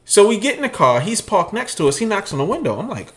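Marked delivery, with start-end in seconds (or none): none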